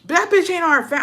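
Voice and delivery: high-pitched, outraged dad impression; worked-up imitation of an angry dad; heated. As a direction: cry out indignantly, pitch way up. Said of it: "He was heated too".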